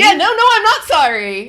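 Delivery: loud, high-pitched mock outrage; emphatic retraction. Taking back her apology to the company, loud indignant protest. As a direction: burst out loudly, rejecting the apology.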